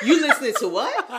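a laughing mock-demand from dad; retold stern father. Daughter imitating father questioning her music, mock-stern outburst. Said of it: "My father's like".

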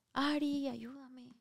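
ordinary talk, nothing marked

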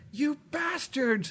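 drawn-out, high-pitched mock scream; imitated scream. Retelling a girl screaming at monsters from the porch, mock scream. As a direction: scream it slowly like the horror actress. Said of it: "starts screaming".

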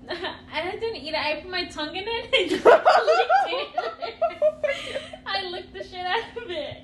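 giggling through the words; high squeal of laughter on it; laughing confession. Real, not acted. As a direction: laugh it out, half embarrassed. Laughing while confessing licking.

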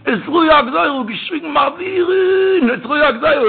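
high, drawn-out shouted cry; shouted prayer cry. Retold or imitated. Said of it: "hat geschrien dort".

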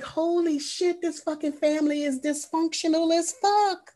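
high-pitched mock disbelief; appalled reaction. Host recalls her reaction to the dysfunctional family, appalled disbelief. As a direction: exclaim it high, scandalized.

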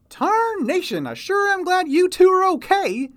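put-on high, loud folksy old-timer voice; old prospector. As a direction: holler it like a grizzled prospector. Chester's excited greeting.

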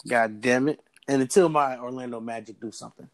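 normal talking voice

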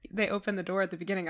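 ordinary talk, nothing marked

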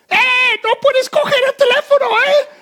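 very high, loud alarmed shout; girl shouting. Retold or imitated. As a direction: shriek it like a worker stopping you. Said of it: "una chica detrás gritando". (grito muy agudo y fuerte)

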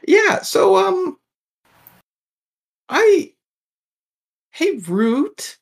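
very high, halting creature voice; hesitant little creature. Acted. Sewer creature hesitantly calls Root over, very high voice. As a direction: squeak it out haltingly, then call out.

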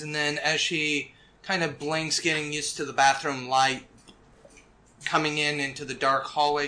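ordinary talk, nothing marked

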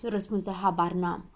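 usual speaking voice

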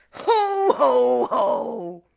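loud jolly ho ho ho; Santa laugh. Acted. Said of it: "that laugh sounded like Scrooge".

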